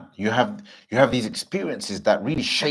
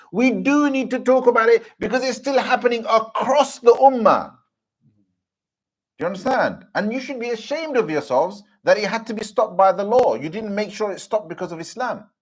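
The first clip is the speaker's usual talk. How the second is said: higher, louder preaching at full force; preaching rebuke; 13 semitones higher, 6 dB louder than usual. Acted. Preacher scolding the community over beatings, fervent preaching.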